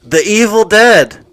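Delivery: speaking up. Real, not acted. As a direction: raise the voice to be heard. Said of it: "Speak up".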